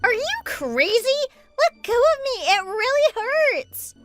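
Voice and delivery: high-pitched whine of pain; high, pained outcry, stretched at the end; pained protest. Acted. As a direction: protest shrilly as your hair is yanked. Hair pulled in acted fight.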